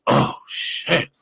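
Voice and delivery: breathy aside. Retold or imitated. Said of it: "I was like".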